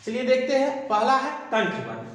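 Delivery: usual speaking voice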